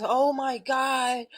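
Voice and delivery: slow, drawn-out fake wonder; mocking an awestruck person; mock awed believer. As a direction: mimic a dazzled believer, slowly.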